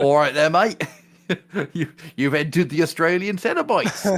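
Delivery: a jokey Australian Cenobite greeting; mock Australian. Host turns the Cenobite Australian, hammed-up Australian accent. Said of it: "like, Australian".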